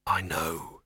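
a touch of grim weariness. Acted. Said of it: "said Victor grimly".

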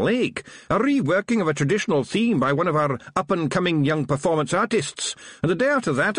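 high, lively Scottish Feegle; chirpy little Scots policeman. A narrator's reading. Feegle boasting about city culture.

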